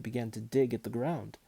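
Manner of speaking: plain reading voice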